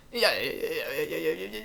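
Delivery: soft, stuttering run of yeahs; sputtering starting car. Retold or imitated. Mimicking the actor's classic habit for a co-host, sputtering like a starting car. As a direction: imitate an old actor revving up like an engine. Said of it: "started his sentences with a starting car".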